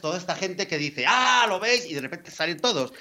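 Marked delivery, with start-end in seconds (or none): 1.1-1.8 much higher, smug told-you-so jeer; gloating mock voice. People online gloat at vegans, high smug jeer. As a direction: sneer it like you were proven right.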